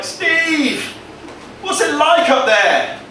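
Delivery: squeaky little plant shouting skyward; nettle buddy calling up. Acted. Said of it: "They're like".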